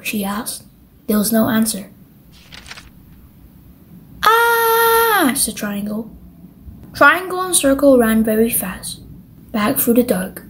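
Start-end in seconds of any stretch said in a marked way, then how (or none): 4.2-5.3 high startled "Ah!" yelp; a sudden high scream of fright. Acted. Triangle scared by the shape in the dark, screaming.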